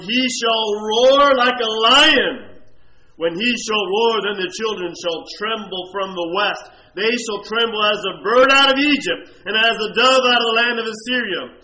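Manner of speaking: preacher thundering the verse; preaching at full force. Acted. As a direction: proclaim it like a lion's roar.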